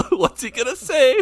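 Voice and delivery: high, laughing mock worry; imitating worried friend. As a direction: imitate his nervous face. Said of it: "you're like".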